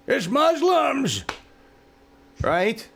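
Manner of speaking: mocking a bigot. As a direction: parody the ignorant blamer. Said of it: "So I'm gonna blame it".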